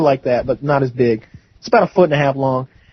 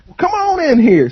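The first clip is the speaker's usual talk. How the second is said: high, hearty holler in character; calling them in; 11 semitones higher than usual. Retold or imitated. Uncle waves his nephew's friends into the trailer, hollering. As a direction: imitate an uncle hollering a welcome. Acted uncle calls guests inside.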